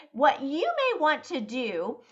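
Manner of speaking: ordinary talk, nothing marked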